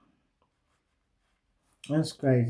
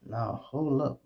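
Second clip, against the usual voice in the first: ordinary talk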